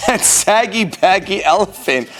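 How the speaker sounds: animated, theatrical picture-book reading; children's story narration. A narrator's reading. Storyteller reading a parade book to children, animated narration. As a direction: read it out with exaggerated swings of pitch.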